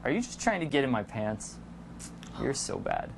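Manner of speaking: low, soft, half-whispered teasing; playful flirty tease. Shown as a demonstration. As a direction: hush it like a private joke.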